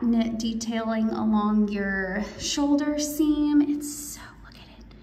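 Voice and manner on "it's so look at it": trailing awed whisper. Real, not acted. Whispering to viewers over the shoulder seam detail. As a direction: hush right down, pointing something out. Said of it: "I don't know why I'm whispering".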